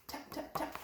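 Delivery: whispered tap tap tap; hushed playful tapping; soft tapping whisper. Acted. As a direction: breathe the taps softly. Tapping the shell softly.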